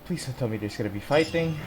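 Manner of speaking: ordinary talk, nothing marked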